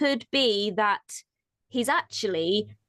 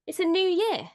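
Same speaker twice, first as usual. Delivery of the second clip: upbeat cheerful; 7 semitones higher than usual. Real, not acted. Upbeat new year mantra.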